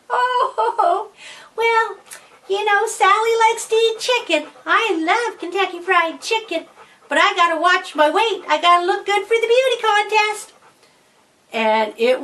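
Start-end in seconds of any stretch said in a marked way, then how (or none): 0.1-10.5 put-on high-pitched, affected girly voice; Sally. Boy explaining his appetite to the girls, fake girly voice. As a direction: play a disguised boy excusing himself in falsetto. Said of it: "Billy Boy just went".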